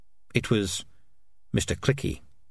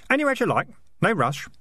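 put-on innocent, high boy's voice; mock helpful; 9 semitones higher than usual. A narrator's reading. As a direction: sweetly needle the piper. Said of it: "said Keith, helpfully".

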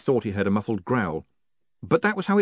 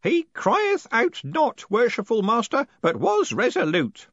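high, slow ceremonial proclamation; old man's high ritual declaration; raised voice; 13 semitones higher, 4 dB louder, slower than usual. A narrator's reading. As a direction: proclaim it for watchers, drawn out. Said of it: "then raised his voice to add".

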